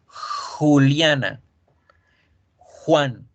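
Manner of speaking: Juliana and Juan with a harsh throaty j; slow, rasping Spanish jota; Spanish jota scraped from the throat. Shown as a demonstration. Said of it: "no suena como lo hacemos en español".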